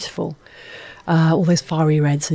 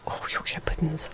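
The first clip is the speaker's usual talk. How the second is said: whisper; 12 dB quieter than usual. Acted. Savouring food.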